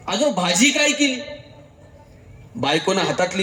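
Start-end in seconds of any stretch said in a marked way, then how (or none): none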